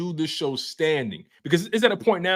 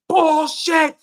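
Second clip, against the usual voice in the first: high, loud burst of protest; outraged heckle; 13 semitones higher, 5 dB louder than usual. Acted. Dad protesting the spelling bee proctor, outraged heckle. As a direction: heckle the official at full voice, acted.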